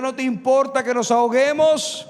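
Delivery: loud, high frantic yell; shouted. Acted. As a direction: shout it at a sleeping Jesus. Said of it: "y ellos le gritan".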